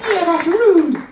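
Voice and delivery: high squeaky puppet voice; Minnie Paul. Acted. Dummy answers in puppet voice.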